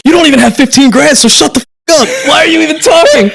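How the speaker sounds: high, loud, snarling quoted insult; mock angry yell. Quoting Wes's nasty message back to him, mock furious. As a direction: bark it like an arrogant bully. Retold hostile message from Wes.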